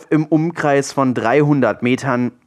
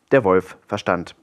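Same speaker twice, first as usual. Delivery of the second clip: usual speaking voice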